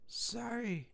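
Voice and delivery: high, soft, eerie woman's voice; ghostly woman's voice. Retold or imitated. Friend imitates the ghost woman on the tape, high and soft. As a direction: breathe it like a ghost apologizing. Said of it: "a woman's voice go".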